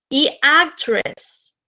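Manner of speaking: actress, slow and careful; slow English word. Shown as a demonstration. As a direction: model actress slowly. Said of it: "Actor se utiliza exclusivamente". (actress lento y claro)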